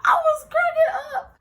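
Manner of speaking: high, loud laughing cry; high laughing shout; cracking up. Real, not acted. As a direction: laugh it out helplessly. Laughing hard.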